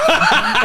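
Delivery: a sudden high laugh bursts out; high burst of laughter. Real, not acted. Remembering the water slide drop, a high burst of laughter. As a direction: laugh high at your own story.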